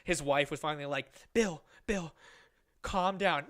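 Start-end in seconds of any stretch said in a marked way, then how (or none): 1.3-3.4 higher, insistent appeal; imitated calming plea. Wife calling off her angry husband, imitated calming plea. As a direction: call him off firmly.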